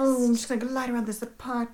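languid diva. Retold or imitated. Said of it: "she's just like".

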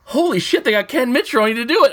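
high-pitched thrilled reaction; excited outburst. Retold or imitated. Fan reacting to seeing the premiered artwork, excited. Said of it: "I was so excited".

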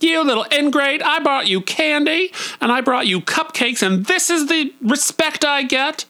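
very high, hammed-up camp tirade; shrieky theatrical indignation; Dawn Davenport. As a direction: play an outraged diva berating a child. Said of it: "but I'm Dawn Davenport".

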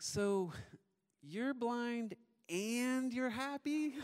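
put-on very high, slow toddler voice, drawn out to a question; puzzled little daughter. Two-year-old checking what Dad told her, high slow puzzled imitation. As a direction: mimic a small child slowly putting it together. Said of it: "she's really thoughtful and reflective".

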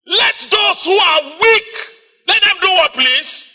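high, loud, drawn-out preacher's shout; full-force preaching. Real, not acted. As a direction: drive the scripture home to the congregation.

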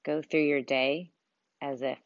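soft, unhurried meditation voice with long pauses; guided meditation. Acted. Coach leads listeners through breathing and visualizing their dream life, guided meditation. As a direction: guide them gently through breathing and imagining, softly.

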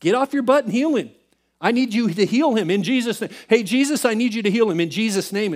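high, loud, mock-bossy prayer; demanding attitude. Ordering Jesus to heal Ryan, mock demanding. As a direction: order him about, hammed-up. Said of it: "but our attitude, mine".

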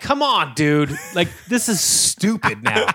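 mock exasperation. Man to buddy behind locked door, exasperated. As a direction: act fed up with a friend's prank.

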